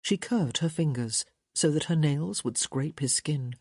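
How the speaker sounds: ordinary delivery, nothing marked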